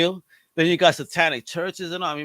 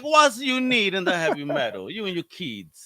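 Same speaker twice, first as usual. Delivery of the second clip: bad Italian accent; 2 semitones higher than usual. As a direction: read it in a hammed-up Italian accent. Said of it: "trying to do a bad Italian".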